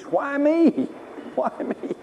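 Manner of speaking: high mock whine, then a laugh; mock the self-pitying cry; whiny why me. Preacher mocking the self-pity of sufferers, high whine.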